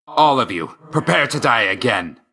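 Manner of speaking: commanding combat threat; battle cry. Acted. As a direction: challenge a crowd of foes.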